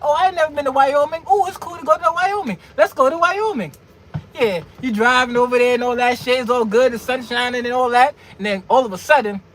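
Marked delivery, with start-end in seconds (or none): 0.0-3.7 high, eager clueless rookie; mock-naive, high-pitched enthusiasm; naive newbies. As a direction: gush like a rookie who knows no better. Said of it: "those that don't know no better".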